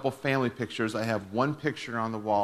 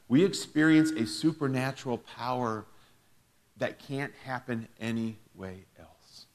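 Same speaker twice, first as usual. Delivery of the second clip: normal talking voice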